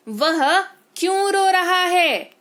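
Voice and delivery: usual speaking voice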